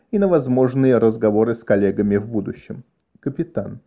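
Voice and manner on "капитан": hushed, much softer question; шёпот. A narrator's reading. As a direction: call to the captain under your breath. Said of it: "спросил он шёпотом". (тихо, почти шёпотом)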